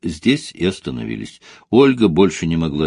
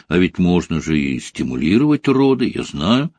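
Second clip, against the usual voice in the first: plain reading voice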